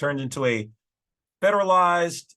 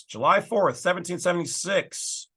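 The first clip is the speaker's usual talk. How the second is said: usual speaking voice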